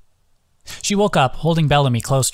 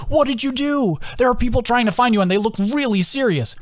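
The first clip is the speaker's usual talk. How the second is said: much higher, anxious put-on voice; mock worried friends; 11 semitones higher than usual. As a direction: squeak it like panicked friends. Friends voiced in retold story.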